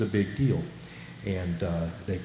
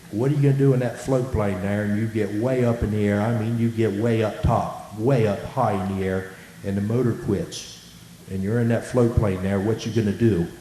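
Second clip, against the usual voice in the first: put-on louder, emphatic Hatteras island accent; 4 dB louder than usual. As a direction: put on your best Hatteras accent, pushing it out loud. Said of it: "give my best Hatteras accent here".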